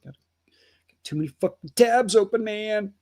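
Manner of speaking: ordinary talk, nothing marked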